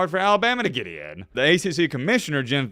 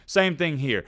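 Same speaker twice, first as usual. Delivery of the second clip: usual speaking voice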